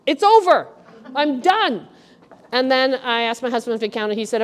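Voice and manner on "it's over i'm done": relieved. Retold or imitated. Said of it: "I'm like".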